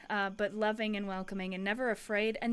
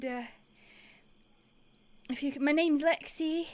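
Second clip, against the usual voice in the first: higher, louder nervous call; pleading, raised and nervous; nervous Lexi; 6 semitones higher, 6 dB louder than usual. Acted. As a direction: call out anxiously to strangers in the dark trees. Nervous driver calls to hidden guard.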